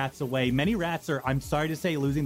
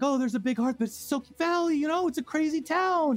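high mock-casual retelling; 7 semitones higher than usual. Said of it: "at first it was like".

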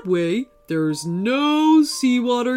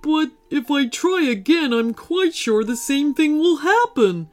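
sad; 4 semitones higher, 3 dB louder than usual. Acted. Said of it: "explained Gordon sadly".

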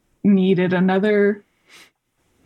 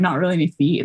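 ordinary talk, nothing marked

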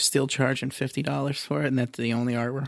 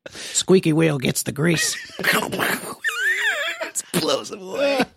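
laughing; 11 semitones higher than usual. Acted. Laughing joke voice.

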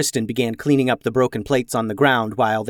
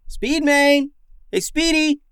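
calling out. A narrator's reading. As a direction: shout for a pet lion to come. Auden calling for his lion.

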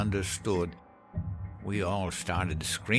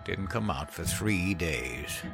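wheezing; 6 dB quieter, slower than usual. A narrator's reading. Henry recalling the grieving mother, wheezing softly. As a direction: wheeze it out slowly. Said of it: "Henry wheezed".

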